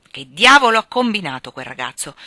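loud, blustering interrogation; angry demand. A narrator's reading. Old Lawrence confronts Jo about the boy, angry demand.

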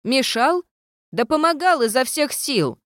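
indignant, voice shooting up high; protest, deeply insulted; offended. A narrator's reading. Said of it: "оскорблённо вытянулось".

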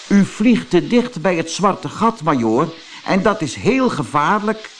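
high, polite warning; small character cautioning a superior; earnest little warner. Acted. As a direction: warn him in a high, earnest voice. Manusje warns the major.

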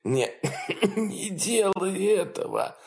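soft, choked croak; wheezing cough. Acted. Dying father coughs out his last plea. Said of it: "продолжал хрипеть папа".

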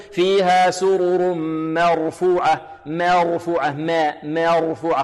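مرفوعة with ma tilted towards meh; chanted مرفوعة starting with a meh sound; over-thinned vowel, wrong way. Shown as a demonstration. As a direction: demonstrate the fault, fronting the a of مرفوعة. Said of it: "نحذر من تقليل فتحة الميم".

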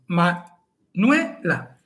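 Manuela very slow, stress on nue; slow syllable by syllable. Shown as a demonstration. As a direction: stretch out Manuela, leaning on nue. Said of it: "Así que es fuerte". (Manuela muy despacio, acento en nue)

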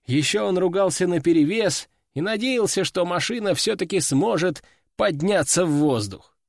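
ordinary delivery

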